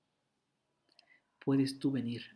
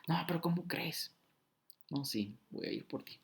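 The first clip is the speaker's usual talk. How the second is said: Jesús susurrando; 3 semitones higher, 5 dB quieter than usual. Acted. Jesus answers softly.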